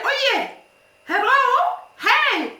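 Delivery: "Oh yeah, hello, hey" with a pause after each part; reversed with pauses. Shown as a demonstration. Showing how Trinidad flips the phrase, paused between "Oh yeah", "hello" and "hey". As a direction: set "hello" and "hey" apart, lifting "hey". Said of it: "pause, and reverse it".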